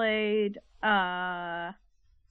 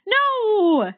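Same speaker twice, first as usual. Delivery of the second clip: shocked no; 11 semitones higher, 11 dB louder than usual. Real, not acted. Reacting to a spoiled goal.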